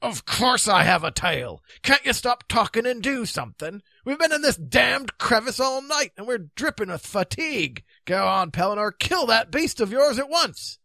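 high, blustering character voice; Grummore, exasperated. Acted.

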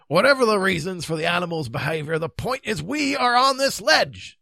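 loud, emphatic, impatient knight; exasperated knight. Acted. Knight reminding the king of their plight, exasperated. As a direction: hammer the point home. Narrator voices exasperated Grummore.

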